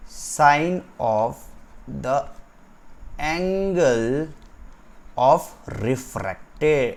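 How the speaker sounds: very stretched words, long gaps between phrases; slow dictation. Shown as a demonstration. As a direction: dictate it slowly, repeating for writers. Dictates a definition to write. (रुक-रुक कर लिखवाते हुए)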